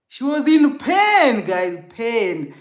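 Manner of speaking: anguished outburst; anguished emphasis. Real, not acted. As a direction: cry out the word pain. Emphasizing grandma's pain.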